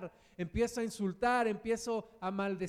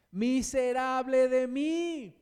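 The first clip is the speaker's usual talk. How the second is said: slow, loud and higher lament; anguished outcry; 4 semitones higher, 7 dB louder, slower than usual. Acted. Preacher reading Paul's lament, a loud slow cry.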